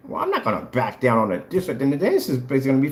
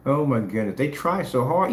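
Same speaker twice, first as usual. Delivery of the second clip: ordinary talk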